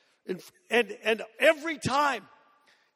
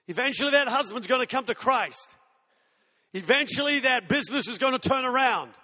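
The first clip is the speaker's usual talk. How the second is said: rising, insistent preacher's cadence; stirring sermon crescendo; preaching build; 2 semitones higher, 4 dB louder than usual. Acted. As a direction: build each eventually toward a climax.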